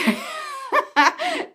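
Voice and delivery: a high burst of laughter. Real, not acted. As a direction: laugh up high at your own joke. Laughs at own joke.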